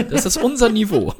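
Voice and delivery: laughing while getting the words out. Real, not acted. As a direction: crack up on the punchline. Joke about a burger place name.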